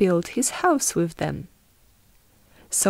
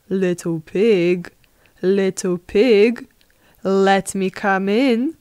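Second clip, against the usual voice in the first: louder, measured call in a put-on wolf voice; 4 dB louder than usual. Wolf at the pig's door asking entry, calling. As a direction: call out each phrase as the storybook wolf.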